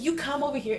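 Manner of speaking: high, imitated bossy summons; mock stern order. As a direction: call her over, mock stern. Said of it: "she gets so mad".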